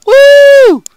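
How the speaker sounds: high delighted woo; excited whoop. Real, not acted. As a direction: whoop with joy. Celebrating new spell.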